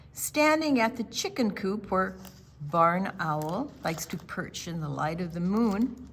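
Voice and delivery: usual speaking voice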